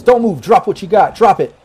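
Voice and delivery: high, loud barked order at gunpoint; armed guard command. Acted. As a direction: bark it down the gun barrel. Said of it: "and just says".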